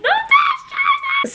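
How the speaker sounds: very high squeal on jersey; squealed finale. Real, not acted. Big finale reveal.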